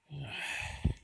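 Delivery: exasperated sigh. Real, not acted. Creator told to label the videos, sighing in exasperation. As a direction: sigh that starts high and falls.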